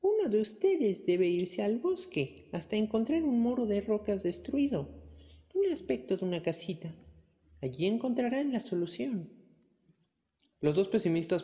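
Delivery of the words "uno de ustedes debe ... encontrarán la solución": put-on old woman voice. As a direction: guide them like a wise granny. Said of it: "repuso la anciana".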